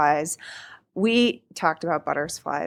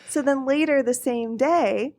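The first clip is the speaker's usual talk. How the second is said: ordinary talk, nothing marked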